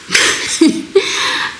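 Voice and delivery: short breathy nervous laugh. Real, not acted. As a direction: laugh out of nerves. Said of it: "c'est un rire nerveux".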